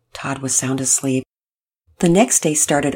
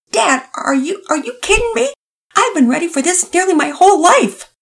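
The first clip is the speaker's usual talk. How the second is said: much higher, bursting with excitement; a boy who's waited his whole life for this; Todd, overjoyed; 6 dB louder than usual. Acted.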